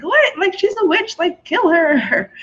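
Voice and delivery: high-pitched mock outrage of an accusing crowd; mock witch-hunters. Past-life crowd turning on a witch, mock alarm. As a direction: imitate a scandalized mob, pitched well up.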